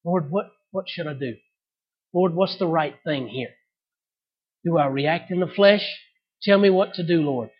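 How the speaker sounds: softly spoken prayer; a believer quietly asking God for guidance. Shown as a demonstration. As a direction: pray it under your breath.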